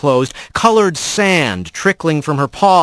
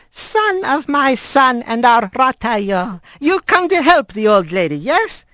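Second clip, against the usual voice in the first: put-on old squirrel grandmother; 9 semitones higher than usual. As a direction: coax him like a fond old granny. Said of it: "and said in Manx".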